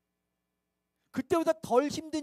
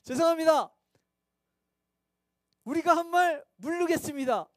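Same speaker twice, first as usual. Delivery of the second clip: louder, higher urgent begging; pleading apology; 4 semitones higher, 4 dB louder than usual. Shown as a demonstration. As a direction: cry out sorry and take it all back. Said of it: "이래야 되는 거 아니에요?".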